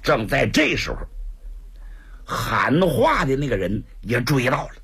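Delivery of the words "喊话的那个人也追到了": usual speaking voice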